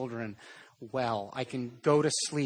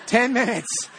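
said much higher and louder through a laugh; laughing repeat; 9 semitones higher, 8 dB louder than usual. Real, not acted. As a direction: laugh it out, amused at himself.

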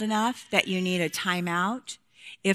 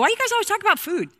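put-on whiny kid; 13 semitones higher, 5 dB louder, much faster than usual. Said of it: "the kids would always go".

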